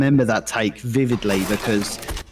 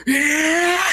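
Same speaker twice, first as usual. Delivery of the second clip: high, charging war cry; battle yell. Retold or imitated. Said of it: "turning around and going".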